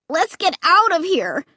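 cry out. A narrator's reading. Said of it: "I heard Clay cry".

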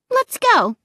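high, eager urging; keen kid's urging. A narrator's reading. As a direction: push them to get moving, pitch way up. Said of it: "I urged".

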